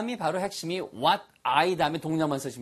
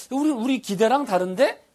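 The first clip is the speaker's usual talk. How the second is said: a touch of surprised complaint, higher and louder; mock surprise; 8 semitones higher, 4 dB louder than usual. Teacher voicing a surprised customer in a lesson. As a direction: act a little startled.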